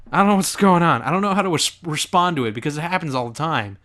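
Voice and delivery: normal talking voice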